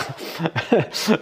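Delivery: short real laugh at her own image; laughing at an absurd picture; amused laugh. Real, not acted. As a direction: burst out laughing softly. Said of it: "mon image, elle me fait rire".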